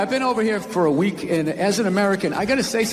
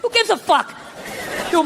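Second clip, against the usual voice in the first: ordinary talk, nothing marked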